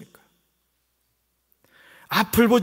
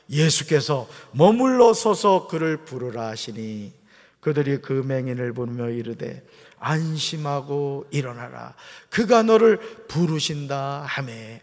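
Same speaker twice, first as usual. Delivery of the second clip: slow, measured reading of scripture; 6 semitones lower, slower than usual. Shown as a demonstration. Pastor reading the Bible aloud with the congregation, slow and measured. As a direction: read the verse slowly aloud together. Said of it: "말씀 같이 읽습니다".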